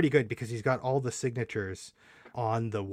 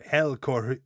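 ordinary delivery

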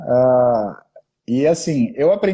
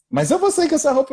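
higher, alarmed wife voice; worried wife voiced; 9 semitones higher than usual. Wife protests about going out in the short dress, higher voice. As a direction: she balks at wearing the short dress.

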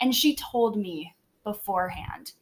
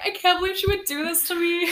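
retold tearful; 8 semitones higher than usual. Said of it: "I literally start crying at school".

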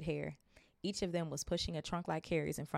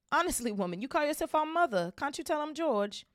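much higher, louder, hammed-up outrage; mock indignant; 11 semitones higher, 6 dB louder than usual. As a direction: scold mum in jest. Twin teasing mother.

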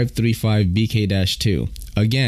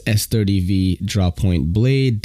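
usual speaking voice

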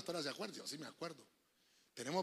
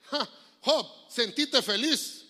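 mocking Elifaz; 7 semitones higher, 9 dB louder, much slower than usual. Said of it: "llega Elifaz y le dice".

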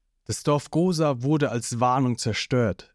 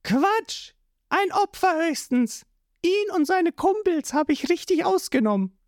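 put-on much higher, scornful girl's voice; Nami's voice. A narrator's reading. Nami scoffing at Zorro, in a high voice. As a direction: brush it off high and cocky.

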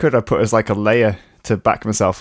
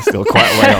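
laughing; 5 dB louder than usual. Real, not acted.

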